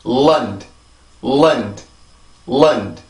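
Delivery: Lund as "lund", long L, reduced vowel; small-beat syllable. Shown as a demonstration. Teacher drilling island's second syllable, Lund with reduced vowel. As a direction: say Lund as one falling beat, light final D. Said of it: "리듬을 만들어 봅시다".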